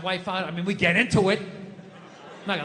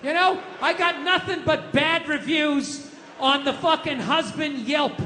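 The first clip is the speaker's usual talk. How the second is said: ranting; 6 semitones higher than usual. Real, not acted. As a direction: rant it out, worked up. Ranting about wife's criticism.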